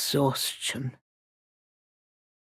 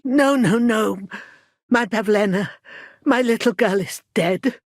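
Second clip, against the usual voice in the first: high cry easing into grief; anguished, higher-pitched lament; distraught denial; 4 semitones higher than usual. Acted. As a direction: refuse the news, grieving. Acted news of daughter's death.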